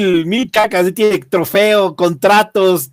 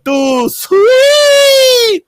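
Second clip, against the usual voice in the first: hype shout; 4 dB louder than usual. Acted. Wrestling promo hype call.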